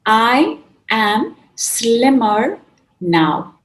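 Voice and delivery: "I am slimmer now", very slow and loud; slow affirmation. Shown as a demonstration. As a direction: land it slowly. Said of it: "try this one".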